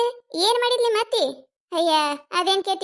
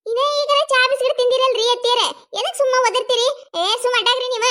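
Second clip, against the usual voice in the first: high, raised retort to mother-in-law; pitched-up telling-off; exasperated scolding; 6 semitones higher, 4 dB louder than usual. Acted. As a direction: shut down the nagging, fed up. Daughter-in-law scolds mother-in-law back.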